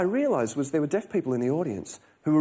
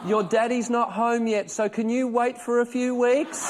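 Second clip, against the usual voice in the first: put-on high, sweet little-girl voice, slow; a small child talking to the baby bump; voicing his daughter, high and slow; 10 semitones higher, 3 dB louder, slower than usual. Daughter talking to wife's belly.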